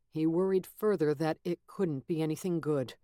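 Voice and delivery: ordinary delivery, nothing marked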